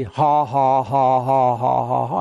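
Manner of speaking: low, steady spoken ha-ha-ha; imitated laugh. Brother Hagin laughing at the devil, imitated forced laugh. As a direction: mimic a dogged, willed laugh. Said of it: "he began to laugh".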